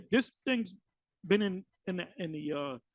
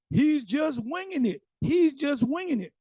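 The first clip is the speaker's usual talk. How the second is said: mocking quote; 7 semitones higher than usual. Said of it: "this clown says".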